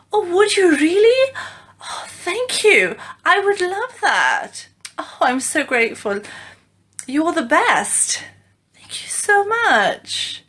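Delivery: high, drawn-out, delighted thanks; gushing gratitude. Shown as a demonstration. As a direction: act out being hugely grateful, stretching the key words. Acting grateful replies to offered help.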